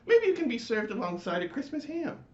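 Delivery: high, loud, indignant chorus voice; fussy neighborhood lights; annoyed lights. Acted. The lights scolding the screaming latke, high and indignant. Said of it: "said the flashing colored lights".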